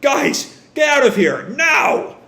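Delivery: high, loud cry of alarm; urgent shout. Retold or imitated. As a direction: shout at the crew to flee. Warning shout before explosion.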